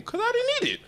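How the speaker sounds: squeaky acted self-defence; high-pitched protest. Child answering an accusing parent, high-pitched mock protest.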